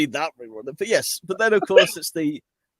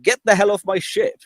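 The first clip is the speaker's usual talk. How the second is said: mock anger; 5 semitones higher, 4 dB louder than usual. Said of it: "Thrawn probably went".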